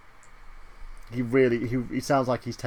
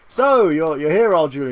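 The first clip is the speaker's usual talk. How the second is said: mock heavenly greeter; 4 semitones higher, 7 dB louder than usual. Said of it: "they're like".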